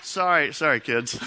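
usual speaking voice

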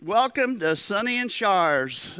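high-pitched restaurant welcome. Retold or imitated. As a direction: imitate an over-the-top waiter welcoming diners. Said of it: "bigger than life".

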